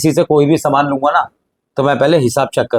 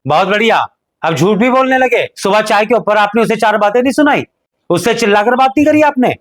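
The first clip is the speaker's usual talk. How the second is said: angry accusation; 6 semitones higher than usual. Acted. Younger brother accuses elder angrily.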